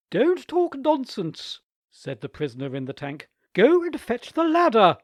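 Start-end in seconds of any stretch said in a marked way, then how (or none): 0.1-1.6 high, slow, loud rebuke; sharp aunt. A narrator's reading. Aunt scolding Nicholas from the tank, sharp rebuke. 3.5-5.0 high, loud order. A narrator's reading. Aunt ordering Nicholas to fetch the ladder, loud.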